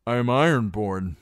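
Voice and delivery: lying boast. Acted. Reek lies to the guard about who he is, forced and loud. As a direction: push the lie out loudly.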